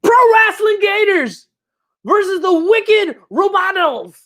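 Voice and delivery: big ring announcer call, high and loud. Pretend. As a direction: belt out the matchup like a wrestling announcer. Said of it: "my announcer voice".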